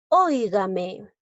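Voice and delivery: "Óigame" slowed, ÓI punched; teacher's stretched "Óigame", strong first syllable; stress on first syllable. Shown as a demonstration. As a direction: hit ÓI hard in a slow "Óigame". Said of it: "Lleva la mayor fuerza de voz".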